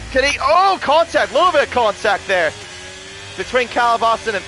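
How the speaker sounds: sudden excited burst at a crash. Real, not acted. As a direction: yelp it as contact happens.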